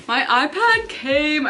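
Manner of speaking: slow, drawn-out thrilled delight; excited. Real, not acted. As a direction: savor the news you've waited for. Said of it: "I'm so excited".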